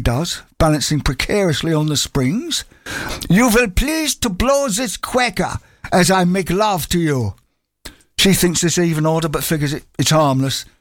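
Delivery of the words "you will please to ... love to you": slow, higher hammed-up German accent. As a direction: pantomime German, drawn out. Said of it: "pantomime German accent".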